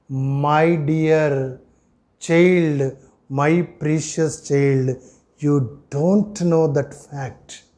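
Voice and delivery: low, soft and very slow; smooth, gentle voice of God. Retold or imitated. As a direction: speak tenderly to a dear child, unhurried. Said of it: "revealed by God Almighty in a smooth manner".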